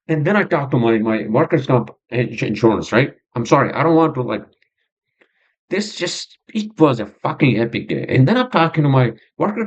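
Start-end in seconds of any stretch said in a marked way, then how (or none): none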